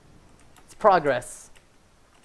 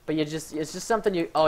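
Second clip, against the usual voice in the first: usual speaking voice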